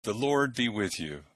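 solemn prayer voice; liturgical prayer. Acted. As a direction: lead the prayers in church. Intones versicles and collects.

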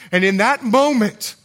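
usual speaking voice

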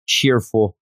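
ordinary delivery

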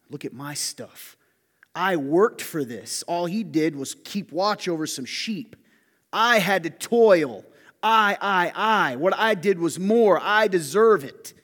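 loud, slow, resentful brother claiming his due; jealous indignation. Retold or imitated. Preacher voicing Cain as a loud, slow, resentful brother. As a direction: pound out each grievance slowly and loudly. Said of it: "That's what he thought".

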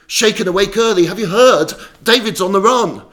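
loud, high, urgent news-breaking; urgent alarm. Acted. Townsfolk waking him early with news, urgent alarm.

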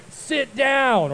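high-pitched, drawn-out order; mock uncle's yell. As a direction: holler it like an alarmed uncle. Retelling the uncle's shouted order.

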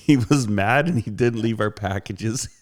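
ordinary delivery

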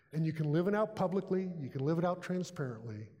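ordinary delivery

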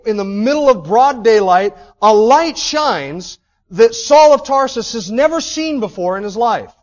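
loud, high-pitched sermon build, slowing on key words; preaching at full force. Acted. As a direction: preach it with rising fire.

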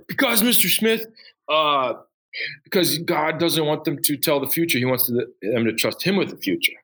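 put-on high, eager kid voice answering; eager student. Teacher imitating a student answering him, high and eager. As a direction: play the keen student who just got it.

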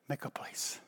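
breathy hushed whisper; whispered divine word. Retold or imitated. Pastor voicing God's call in a hushed whisper. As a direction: whisper it like God speaking softly.

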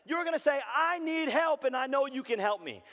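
high, loud, impassioned preaching; full-force preaching. Acted. Preacher urging hurting listeners to ask for help, full-force preaching.

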